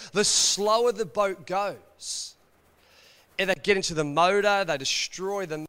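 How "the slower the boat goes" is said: dragging slow, drawn out; slow, weighed-down delivery. Acted. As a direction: let the words crawl like a sluggish boat. Describing the boat slowing down.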